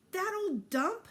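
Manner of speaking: scornful. A narrator's reading. Boy scoffs at house.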